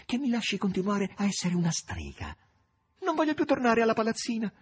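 very high, excited begging; pleading. A narrator's reading. Natascia begs to stay a witch, high and imploring. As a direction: implore in a high, urgent maid's voice. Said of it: "in tono supplichevole".

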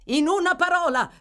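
high, loud, impatient demand; urgent shout. Acted. Gentleman demanding news of the patient, urgent shout. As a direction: cry out for the answer. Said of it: "gridò".

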